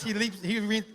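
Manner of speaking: ordinary delivery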